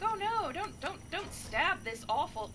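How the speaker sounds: high, panicky protest; mock alarm. Servants stopping the wife from stabbing, high mock panic. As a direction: cry out in alarm to stop the stabbing. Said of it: "and they're all like".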